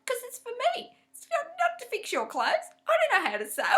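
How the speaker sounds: high, loud exasperated protest; nearly shouting through a laugh; insisting to the husband, loud and high. Retold or imitated. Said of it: "I'm like".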